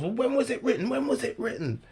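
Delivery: high-pitched frantic questioning; mock his worked-up demand; imitating going nuts. Throwing the heckler's question back at him, high and frantic. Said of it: "you went nuts".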